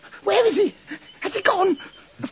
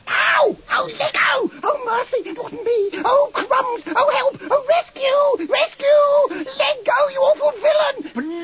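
high panicked cries for help; fluttering cries for help; 7 semitones higher, slower than usual. Acted. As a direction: howl for rescue in terror. Bunter seized, cries for help.

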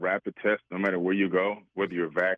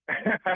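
laughs; 13 semitones higher than usual. Real, not acted. Disguise revealed, guest laughs.

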